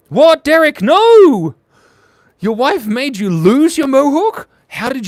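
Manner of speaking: loud, high shocked outcry; can't believe what Derek says. Real, not acted. Streamer reacts to Derek losing his mohawk, loud disbelief.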